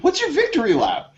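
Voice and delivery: high emphatic repeat of the question. Retold or imitated. Repeating question to confused boss.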